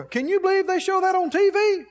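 playing a scandalized viewer; mock outrage. Imitating TV watcher.

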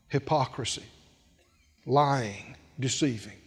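low, soft, spat-out list; bitter muttered accusation. Acted. Older son accusing his father, low and soft. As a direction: mutter each word darkly. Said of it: "the evil voice of unbelief".

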